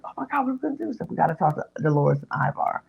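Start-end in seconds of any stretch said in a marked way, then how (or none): 0.0-0.9 high, softened fretting; mock panic. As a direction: imitate friends in a fluster. Retelling the two women's panic.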